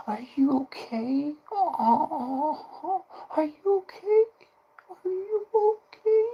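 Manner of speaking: very high, slow sing-song mock pity; high cooing baby talk, mocking; mock sympathetic coddler. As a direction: parody a cooing consoler. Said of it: "people going".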